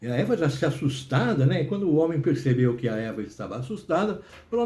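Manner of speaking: ordinary delivery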